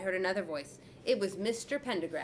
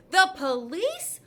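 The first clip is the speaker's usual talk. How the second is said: much higher, louder shocked cry; boy hiding, shocked by police mention; alarmed; 11 semitones higher, 10 dB louder, slower than usual. A narrator's reading. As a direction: panic at the word. Boy horrified hearing police.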